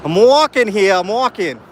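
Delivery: New York accent. Shown as a demonstration. Streamer hams up his New York accent for a chat viewer. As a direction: belt it out like a New York cab-dodger, in a Northeastern US accent. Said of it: "Northeastern U S accent?".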